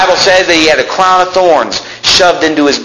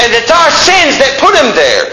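ordinary delivery

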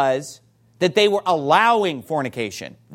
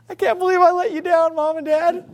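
put-on high, tearful kid voice; put-on high-pitched weepy apology; mock crying child; 11 semitones higher than usual. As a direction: imitate a child crying in remorse to parents. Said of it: "they start crying".

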